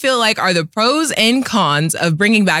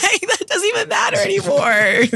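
high laughing shout; 11 semitones higher than usual. Real, not acted. Teasing a friend about choosing Michigan, laughing.

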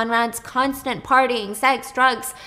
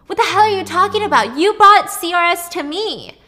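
louder and higher, furious; angry shout; 8 semitones higher, 9 dB louder than usual. Retold or imitated. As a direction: throw the blame back at him. Brothers arguing in car.

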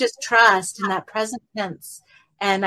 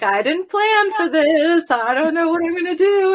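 mock panic; 9 semitones higher, 4 dB louder than usual. Herself before class, mock panicked, higher and louder. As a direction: play your own anxious self, hammed-up. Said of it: "I'm like".

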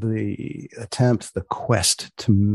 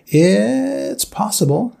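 playful sign-off; 10 semitones higher, 4 dB louder, much slower than usual. Acted. Podcast sign-off.

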